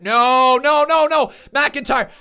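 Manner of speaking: begging him to kick out; desperate shouting. Real, not acted. Desperate live reaction to pin.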